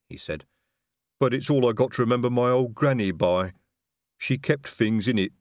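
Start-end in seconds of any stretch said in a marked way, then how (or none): 1.2-5.3 placid, plodding troll. Acted. Voices troll in narration.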